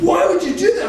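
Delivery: can't believe what he's hearing; incredulous clerk. Retold or imitated. Vitamin clerk baffled by the comedian, high incredulous. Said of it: "He said".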